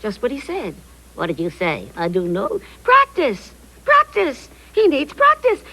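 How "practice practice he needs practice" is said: high, excited insistence; emphatic exclamation. Acted. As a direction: pipe up with the obvious answer. Excitedly announcing the obvious answer.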